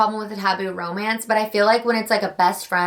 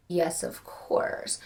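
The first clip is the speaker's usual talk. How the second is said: whispered, slow guilty yes; whispered confession; 4 semitones lower, 10 dB quieter, much slower than usual. Real, not acted. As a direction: whisper it like a secret. Answering own smut question.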